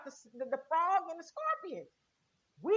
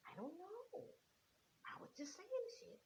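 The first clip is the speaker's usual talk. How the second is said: much softer, a sheepish clueless mumble; 4 semitones higher, 19 dB quieter than usual. Retold or imitated. Clueless voter, asked what the slogan meant, mumbles sheepishly. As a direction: shrug it off quietly like someone caught out.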